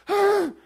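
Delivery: high sharp gasp of dismay; mock jealous gasp; envious gasp. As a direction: gasp as if stung by their luck. Reacting when others get blessed.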